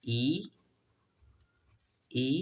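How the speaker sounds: "ই" set apart as its own ee vowel. Shown as a demonstration. Teacher shows learners the second sound of E, "ই" as ee. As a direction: say "ই" as a clear ee sound. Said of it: "তিন ধরনের".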